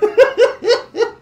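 çok tiz patlayan kahkaha; kahkahayı tizden koy. Real, not acted. Kuş kafasını görünce. (kuşun haline tiz kahkaha)

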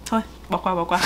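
usual speaking voice

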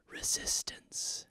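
breathy whispered one-word tagline; dramatic ad whisper. Pretend. Perfume commercial tagline, dramatic whisper.

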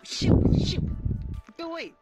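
ordinary talk

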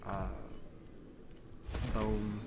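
ordinary delivery

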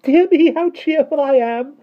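very high, loud, cheerful trill. A narrator's reading. Miss Tartlett turning circles in the air, trilling. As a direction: trill it out with glee. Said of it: "she trilled".

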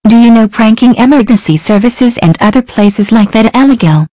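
loud, high telling-off; scolding operator. Pretend. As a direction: scold the prank caller.

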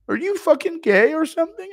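high, loud mocking squeal; Schmitty impression. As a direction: squeal the question at him. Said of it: "Impression of Schmitty asking".

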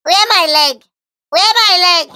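very high, alarmed questioning; panicked cry. Acted. Fighter lifted off the floor, high panicked cry. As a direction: squeal it in mock panic.

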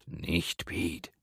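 hushed, urgent warning; soft, secretive hiss; whispering. A narrator's reading. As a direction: whisper it so nobody overhears. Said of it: "flüsterte er".

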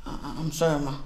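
low, soft stammered sorry; hushed, halting and submissive; cowed apology. Acted. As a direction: apologise meekly after a scolding. Scolded cook apologises.

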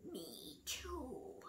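a worried iguana whispering along. A narrator's reading. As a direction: barely breathe it. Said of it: "whispered Iguana".

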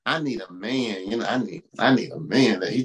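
a hint of a wistful woman; mock woman's voice. Preacher mimicking a woman who wants a sports fan. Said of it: "what if you meet the man".

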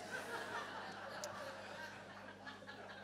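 breathy high laugh bursting out; real burst of laughter. Real, not acted. Woman recalling her mother's swearing, real burst of laughter. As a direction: laugh helplessly at the memory.

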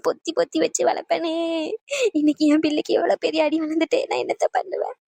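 put-on a mother lamenting her injured child; tearful wailing. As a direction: sob between halting phrases. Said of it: "ஏன் இப்படி அழுதுகிட்டே இருக்கீங்க".

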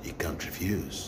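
low, hushed threat; menacing. Shown as a demonstration. Teacher showing how to say he can't refuse, hushed and menacing. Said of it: "وتحمر له عينيك كده وأنت بتقولها".

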